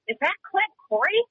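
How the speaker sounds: put-on catching sight of an old friend in Walmart; retold double take. Recognizing rodeo champion in a store, surprised rising question. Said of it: "I looked at him and I said".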